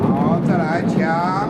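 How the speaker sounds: loud teacher's call over drums. Real, not acted. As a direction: call the next round out over the drumming. Calling out over the drumming.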